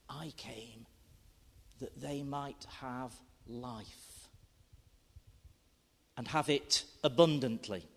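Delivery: hushed solemn scripture; scripture reading. Acted. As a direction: read the verses with reverence. Reads scripture in poem.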